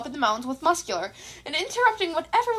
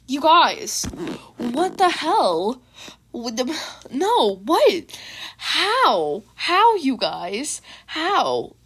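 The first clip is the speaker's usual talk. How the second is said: real disbelief; 3 dB louder, much slower than usual. Real, not acted. Reader reacting to kudos stats.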